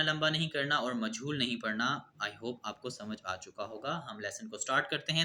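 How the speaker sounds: ordinary delivery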